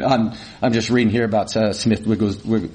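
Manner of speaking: low, quick mumbling; half-swallowed words, muttered over the paper; mumbled. Retold or imitated. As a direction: trail off into an indistinct mumble. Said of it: "I just kinda mumbled".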